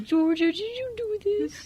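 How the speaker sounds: high, slow mock accusation; suspicious question. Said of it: "I was like".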